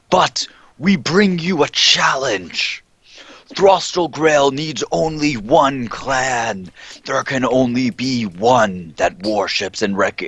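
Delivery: high, slow, grand proclamation; zealous cult herald. Acted. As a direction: announce the challenge like a prophet. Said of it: "And I say".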